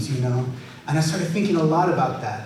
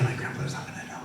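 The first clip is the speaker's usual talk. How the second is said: sneaky whisper; 11 dB quieter, faster than usual. Retold or imitated. Secretly hiding camera from grandpa.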